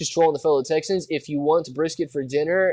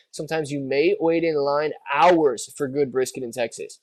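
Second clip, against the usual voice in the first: ordinary talk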